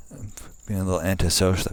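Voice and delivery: ordinary talk, nothing marked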